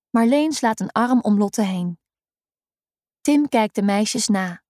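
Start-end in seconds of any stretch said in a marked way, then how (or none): none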